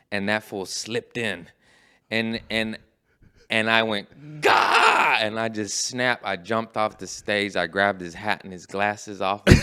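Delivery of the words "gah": sudden high, loud "gah" of rage; explosive scream as the temper snaps; snapping yell. Retold or imitated. Acts out snapping at the heckler.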